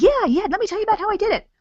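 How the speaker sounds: high, loud, fast mock enthusiasm; hammed-up over-excited seller babbling; really excited. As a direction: gush over them, far too eager. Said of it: "you're gonna get really excited".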